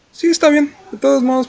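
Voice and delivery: high, breezy imitation of the girl; mimicking the girl. Guy imitates the girl shrugging off the breakup, high and airy. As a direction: toss it off the way she brushed him aside. Girl's reply retold.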